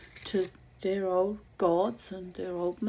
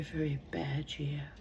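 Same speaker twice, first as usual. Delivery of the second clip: whisper; 4 semitones lower, 7 dB quieter than usual. Real, not acted. Whispered aside.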